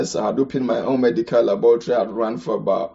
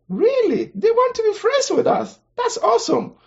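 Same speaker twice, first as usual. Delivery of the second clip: much higher, playful wide-eyed disbelief; mock amazement. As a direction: act delighted and astonished. Mock amazement at survey result.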